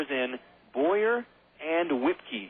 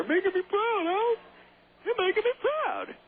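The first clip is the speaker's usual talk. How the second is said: much higher, thin comic voice; Don Knotts impression. Commentator mimics a comedian cheering the Owls, much higher comic voice. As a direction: squeak it out like a proud, jittery comic. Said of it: "That sounds like Don Knotts".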